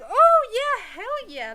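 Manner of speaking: very loud, high, over-the-top yes; exaggerated whooping agreement; mock eagerness. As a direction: jump at the offer with hammed-up enthusiasm. Mom eagerly accepting the date.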